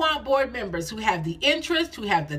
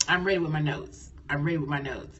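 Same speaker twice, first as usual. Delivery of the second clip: audience reply; 5 semitones lower, 3 dB quieter than usual. Pretend.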